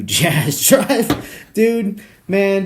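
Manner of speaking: high, loud, laugh-filled cry; laughing outburst. Real, not acted. Comedian cracking up over the Jazz Drive, laughing outburst. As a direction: burst out through laughter.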